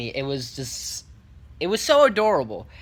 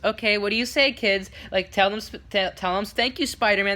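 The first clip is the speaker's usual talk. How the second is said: slightly higher prompting parent voice; imitated mom; 5 semitones higher than usual. Mom prompting kids to thank Spider-Man, lightly imitated. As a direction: lightly coach the kids on manners. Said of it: "one of the moms goes".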